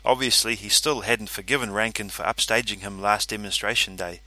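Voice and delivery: plain reading voice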